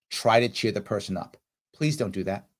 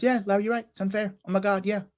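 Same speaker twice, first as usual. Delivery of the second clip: voiced friend agreeing; 7 semitones higher than usual. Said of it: "If she does".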